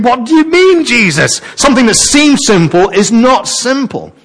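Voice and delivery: high-pitched exasperated puzzlement, retold; really confused. Preacher acting out questioning Jesus over the passage, confused and high-pitched. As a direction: plead for sense, pitch well up. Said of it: "So it gets really confusing".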